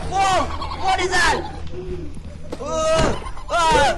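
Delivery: high startled cry; shocked and scared. Real, not acted. Sees monster.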